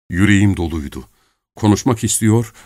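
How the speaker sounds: usual speaking voice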